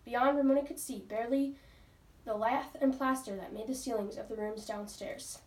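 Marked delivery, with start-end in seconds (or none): none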